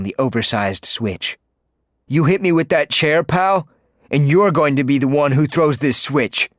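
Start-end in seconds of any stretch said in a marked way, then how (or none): 2.1-6.5 threatening, raised and pushed voice; menacing henchman. Acted. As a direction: lean in and threaten him.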